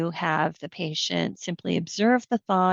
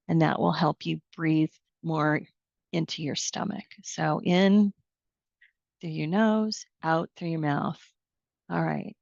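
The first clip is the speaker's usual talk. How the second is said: guided meditation. Shown as a demonstration. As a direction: lead the breaths calmly, letting the voice sink. Leads a guided breathing exercise.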